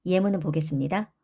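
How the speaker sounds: ordinary delivery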